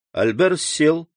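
ordinary delivery, nothing marked

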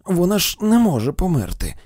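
frightened mother begging; desperate plea. A narrator's reading. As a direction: cry it out as if you can't accept it. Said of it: "тремтячи вона благала".

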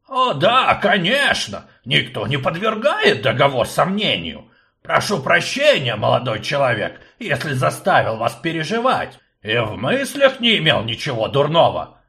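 put-on high, loud, fussy old professor; fussy old man. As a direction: play the fussy old rector. Akcium fawning apology.

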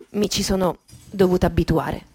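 normal talking voice

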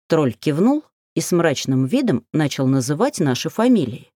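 usual speaking voice

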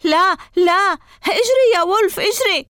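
a very high, loud desperate scream. A narrator's reading. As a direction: scream at the dog to run. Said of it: "صرخت قائلا". (صراخ يائس عالٍ جدا)

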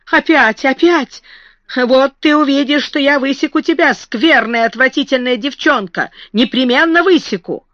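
high, exasperated scolding; worked-up but not shouting; agitated threat. A narrator's reading. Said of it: "то грозно, то нежно и таким возбуждённым тоном".

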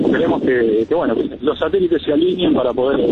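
ordinary talk

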